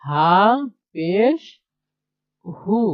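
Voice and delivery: হা, পেশ, হু as separate units with pauses; spelled-out demonstration. Shown as a demonstration. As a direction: punch হা and পেশ, then give the blended হু. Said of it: "এইভাবেই".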